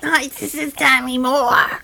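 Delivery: slow raspy celebrity impression; mock husky drawl; raspy Demi Moore voice. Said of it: "she talked with that raspy voice".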